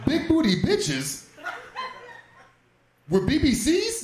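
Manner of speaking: dad reading the search history aloud, stunned; incredulous reading. Retold or imitated. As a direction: read each word like it offends you.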